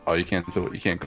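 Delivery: ordinary talk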